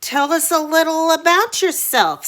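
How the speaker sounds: high, loud, sly creature voice; sly dragon head. Acted. Dragon Snide questioning the boy, high sly creature voice. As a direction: coax him slyly like a monster. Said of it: "said Snide".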